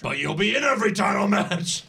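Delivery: mock promoter selling it big; hype pitch. Boss selling Dolph a losing contract, mock hype.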